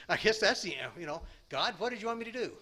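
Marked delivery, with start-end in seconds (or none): 1.5-2.6 higher, fast, exasperated question to God; a higher, faster mock appeal to God; mock plea to God. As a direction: toss it up at God, quick and exasperated. Retold question put to God.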